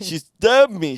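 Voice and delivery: loud, high mock Russian accent; hammed-up Russian cry; exaggerated Russian accent, pushed loud; Victor. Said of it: "He didn't talk like that".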